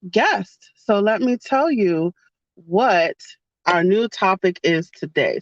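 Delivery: normal talking voice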